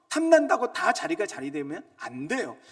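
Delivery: preacher building up; loud preaching. Acted. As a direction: press the point on 탐난다고. Repeated line, builds.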